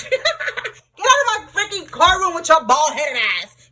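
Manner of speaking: can't keep a straight face ordering them out; laughing mock order. Laughing through mock eviction.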